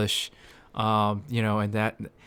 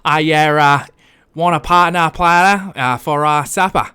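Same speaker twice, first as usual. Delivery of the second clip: JFK impression; 6 dB louder than usual. Continuing JFK impression bit.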